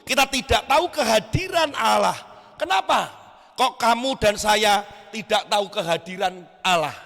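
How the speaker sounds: impassioned sermon delivery, rising and falling; preaching. Acted. Preacher challenging a youth congregation.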